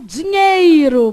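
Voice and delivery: loud, drawn-out "dinheiro" with the I of "ei" sounded; emphatic over-enunciated "dinheiro"; full diphthong. Shown as a demonstration. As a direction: stretch "dinheiro" out as "di-nhei-ro". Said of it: "Você não fala dinheiro".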